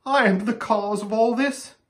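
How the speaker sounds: high, tearful lament; acted distraught cry; bursting into tears. A narrator's reading. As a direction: blame yourself, on the edge of weeping. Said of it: "bursting into tears".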